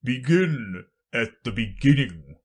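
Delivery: slow, loud, solemn instruction; weighty, drawn-out royal voice; grave king. Acted. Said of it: "the king said gravely".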